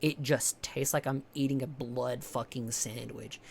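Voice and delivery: normal talking voice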